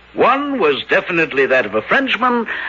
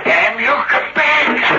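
angry call; 8 semitones higher, slower than usual. Acted. Detective yells at fleeing figure.

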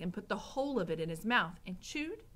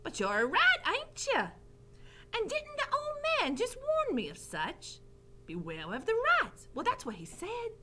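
put-on high, excitable girl's voice; pitched way up, puzzling it out; Mig. A narrator's reading. Mig questioning the rat, high character voice. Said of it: "she said".

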